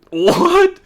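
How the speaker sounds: high, loud incredulous cry; shocked what. Real, not acted. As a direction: blurt it in disbelief. Reacting to absurd chat.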